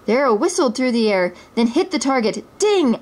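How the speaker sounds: plain reading voice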